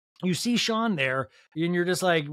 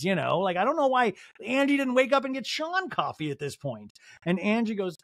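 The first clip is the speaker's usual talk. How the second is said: ordinary delivery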